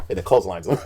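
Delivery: ordinary delivery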